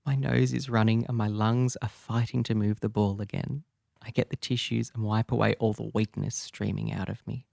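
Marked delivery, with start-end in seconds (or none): none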